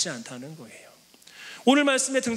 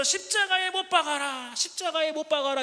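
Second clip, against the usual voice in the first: higher, jeering mob cry; mock crowd clamor; 8 semitones higher than usual. Preacher imitates the mob shouting at Jesus, mild mock yell. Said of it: "아우성치며 소리를 막 꽥꽥 지르니까".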